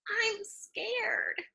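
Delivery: scared whisper. Shown as a demonstration. Huey whispering to his brother, soft and scared. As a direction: hush it like a scared boy. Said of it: "Huey whispered to me".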